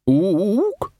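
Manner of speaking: ape's hopeful rising ook; a hooting ape hoping to be picked; high questioning ook. Acted. Librarian ape offers himself to Vimes, hooting high.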